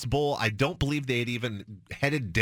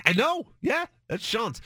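excited agreement; 12 semitones higher than usual. Retold or imitated. Host telling his board op, excitedly high. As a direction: gush like a starstruck fan. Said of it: "I'm like".

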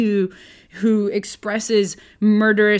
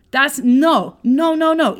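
emphatic no; 5 semitones higher, 4 dB louder, slower than usual. Real, not acted. Rejecting paid prophets.

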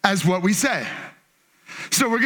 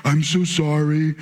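low, slow sorry; humble apology; 7 semitones lower, slower than usual. Shown as a demonstration. Dad showing son the right way, humble. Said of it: "humble yourself and be like".

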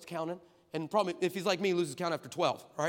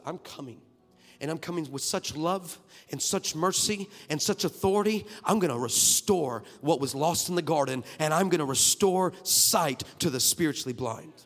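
a firm sermon rise, louder toward the end; preaching; 6 dB louder than usual. Acted. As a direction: declare it with authority. Preaching Jesus' words.

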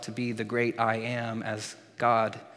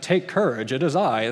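mock stiff English; 4 dB louder than usual. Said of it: "It's just kind of like".

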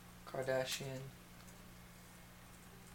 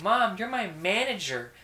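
mock upset daughter; 6 semitones higher, 7 dB louder than usual. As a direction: drag it out like a wounded teen. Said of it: "Voices were spot on".

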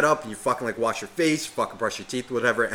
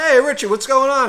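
cheery buddy voice; 13 semitones higher, 9 dB louder than usual. Retold or imitated. Friend answering phone, retold.

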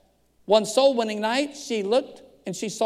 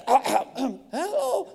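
groggy man faking alertness, pitched up; pretending to be awake; 5 semitones higher than usual. Pastor answers a late-night call, pretending to be awake. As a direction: act wide awake on a woken-up hello. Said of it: "you act like you're still awake".

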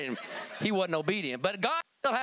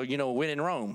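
ordinary talk

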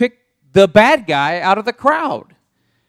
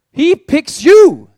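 emphatic proclaim; 8 semitones higher, 8 dB louder, slower than usual. Acted. Preacher's climax, Jesus picks you.

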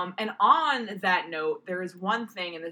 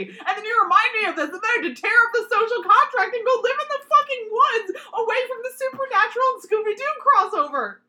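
much higher and louder hammed-up despair; mock fury; 8 dB louder than usual. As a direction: wail it out as comic outrage. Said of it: "I'm so angry".

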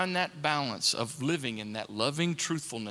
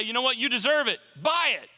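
goading high-energy salesman-like urging; pushy voice of temptation, rising to a loud finish; tempting inner voice; 5 semitones higher, 3 dB louder than usual. Acted. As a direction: egg them on, pitched up and insistent. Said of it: "Voices that say".

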